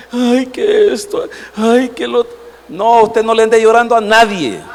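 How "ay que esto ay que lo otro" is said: mock whining lament. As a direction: imitate someone moaning over every little trouble. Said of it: "porque ahora llorando anda".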